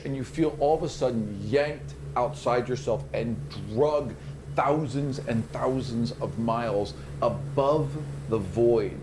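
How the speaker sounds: slow, hushed vision narration; game master's scene setting. Acted. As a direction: draw them into the eerie vision slowly. GM describes the vision.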